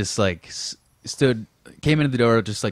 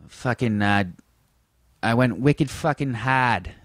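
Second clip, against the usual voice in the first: drawn-out Boston accent demo; slow, broad Boston vowels with dropped R; slower than usual. Shown as a demonstration. Showing how Boston sounds, broad Boston accent. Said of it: "Boston accent is more like".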